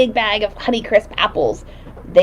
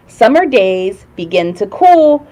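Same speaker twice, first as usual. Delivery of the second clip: put-on lilting kids' show host voice; singsong puppet show host; 3 semitones higher, slower than usual. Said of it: "reading magic words book to kids".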